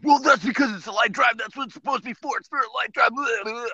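high, nasal-free mocking whine of a critic; mocking know-it-all voice. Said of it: "some people are listening to this and going".

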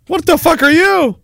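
usual speaking voice

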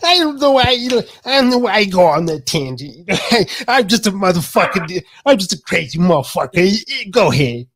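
hyper impression, starting very high and rambling; Mike Tyson. Podcaster imitating a hyped-up boxer for his friend, in a high Mike Tyson impression. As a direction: get carried away in a high, excitable voice. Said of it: "when he gets really hyper, he be like".

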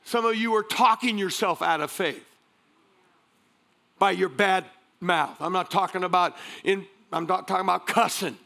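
usual speaking voice

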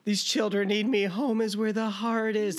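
high, mock earnest quoting; lightly put-on sincere voice. Mock voicing the character.

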